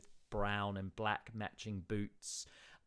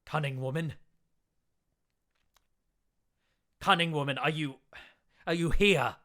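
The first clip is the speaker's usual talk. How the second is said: raised, searching call; 5 semitones higher, 7 dB louder than usual. Acted. Town speaker calling for the cunning woman in the woods. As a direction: call loudly into the dark woods.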